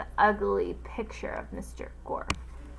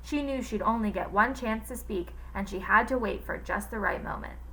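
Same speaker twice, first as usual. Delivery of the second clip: plain reading voice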